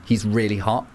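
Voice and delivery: usual speaking voice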